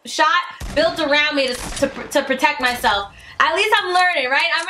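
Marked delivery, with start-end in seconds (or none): none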